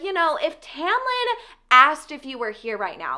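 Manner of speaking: affected character voice with stretched words and lilting rises; put-on Cressida voice. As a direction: play her up, drawing out the ends of phrases. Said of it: "I guess this is her voice now".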